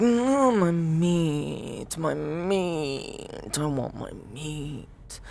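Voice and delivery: high, whiny creature voice, fading and slowing to a soft whimper; sad creature whine. Acted. Erm mourning his stolen raw meat, high whiny sad creature voice. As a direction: plead for your meat like a heartbroken beast, trailing off. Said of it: "Erm is very sad that his meat".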